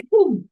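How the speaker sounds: sudden boom. Retold or imitated. Host shows the film suddenly peaking, loud sound-effect boom. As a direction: imitate the drop with an explosive boom. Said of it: "when you hit that point".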